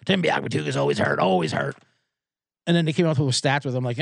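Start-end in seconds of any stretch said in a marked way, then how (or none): 0.1-1.8 very low, monotone sports-talk impression; Scott Farrell. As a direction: drop into a droning deep radio-host voice. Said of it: "He said something like".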